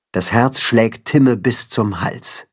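ordinary delivery

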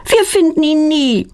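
high-pitched frustrated whine; mildly exasperated, giving up; annoyed. A narrator's reading. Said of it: "ärgert sich Claudia".

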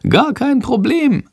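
very high, slow, cocky bat voice; a tiny bat shrugging it off; squeaky bat, unbothered and proud; chirpy little bat. Acted. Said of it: "antwortet Schandor".

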